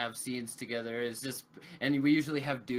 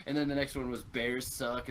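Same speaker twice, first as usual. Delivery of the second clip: usual speaking voice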